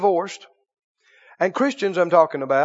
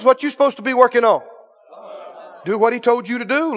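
ordinary talk

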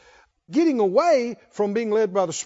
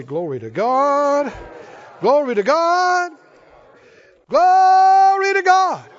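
climbs from normal to very high, loud and slow; building praise shout; 7 semitones higher, 8 dB louder, slower than usual. Shown as a demonstration. Preacher leading the church in praise, building to a roar. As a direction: swell each Glory to God into a roar. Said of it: "Oh, somebody say".

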